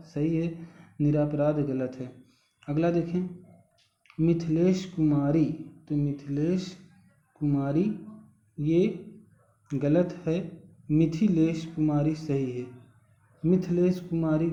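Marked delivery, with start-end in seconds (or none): none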